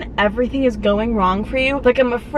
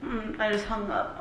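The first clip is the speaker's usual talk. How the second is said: holding back tears; 12 dB quieter than usual. Real, not acted. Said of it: "I'm trying so hard not to cry".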